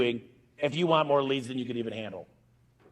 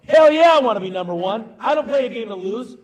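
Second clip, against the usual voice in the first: loud, high competitive outburst; retold rallying cry, punched out loud; fired-up comeback; 6 semitones higher, 7 dB louder than usual. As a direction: fire back, pumped to win.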